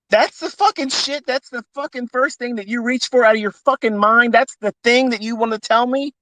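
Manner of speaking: high-pitched furious rant; outraged rant. Real, not acted. Outraged rant, much higher pitch.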